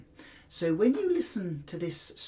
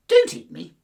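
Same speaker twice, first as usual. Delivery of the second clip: much louder pleading cry; a hint of a terrified squeak; mouse crying out; 3 semitones higher, 13 dB louder than usual. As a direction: mouse begging the lion for its life. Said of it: "cried Mouse".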